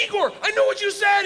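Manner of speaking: high, loud proud shout; boasting yell to Igor; excited shout. Retold or imitated. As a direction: announce it like a triumph.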